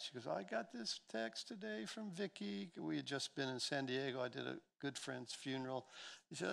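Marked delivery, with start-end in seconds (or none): 0.3-2.7 high, softer imitation of a crying wife; lightly mock-tearful, raised pitch; retold tearful wife. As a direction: voice her upset high and soft. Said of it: "she's crying".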